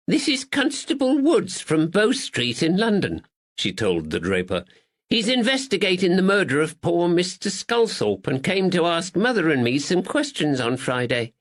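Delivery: high, bright-voiced kindly lady; sweet older lady greeting a visitor; Miss Bennett. Spinster greets the constable at the shop, pleasantly high.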